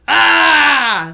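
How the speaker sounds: loud, high cry of "Ah!"; horseman's yell. Retold or imitated. Horseman's only line, a loud war cry. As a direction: bellow "Ah!" like a wordless killer. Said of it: "All his lines are just".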